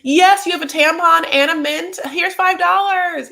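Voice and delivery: put-on loud, high, drawn-out drunken enthusiasm; drunk club-goer. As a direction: gush loudly like you're drunk at a club. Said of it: "like, drunk at a club".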